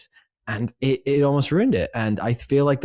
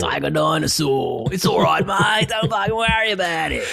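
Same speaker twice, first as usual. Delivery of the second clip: hammed-up dad impression; 7 semitones higher than usual. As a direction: shout it like a cartoonish pommy dad, much higher. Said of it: "that's how he sounds like in my head".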